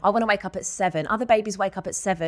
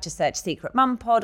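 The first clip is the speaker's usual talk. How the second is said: usual speaking voice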